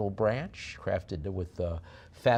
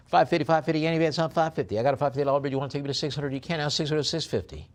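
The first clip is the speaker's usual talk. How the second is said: brisk auctioneer chant, a bit louder; auctioneer bid calling; 5 semitones higher, 4 dB louder than usual. Acted. Auctioneer calling bids to online bidders, brisk. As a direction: rattle off the bids to close the sale.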